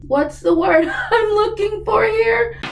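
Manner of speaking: high, drawn-out laughing question; coy word-hunting. Real, not acted. Reviewer hunting for an awkward word, high and amused.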